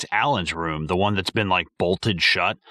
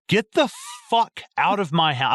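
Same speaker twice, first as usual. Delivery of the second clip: angry order; 8 semitones higher than usual. Pretend. Angry parent ordering exterminator out.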